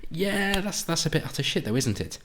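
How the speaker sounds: lampoon the scoffers; mock dismissive. Mocking leftists dismissing the country, high sneering tone.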